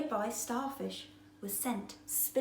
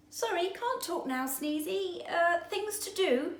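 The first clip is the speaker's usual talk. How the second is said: lightly voiced busy fish, higher; hurried fish; 9 semitones higher than usual. A narrator's reading. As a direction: brush him off in a hurry. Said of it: "said one fish".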